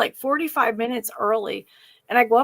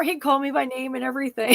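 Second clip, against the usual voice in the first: ordinary talk, nothing marked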